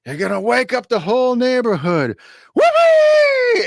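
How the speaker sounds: put-on high, loud drunken whoop. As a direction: bellow it like a happy drunk. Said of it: "he's just insanely loud 'cause he's so drunk".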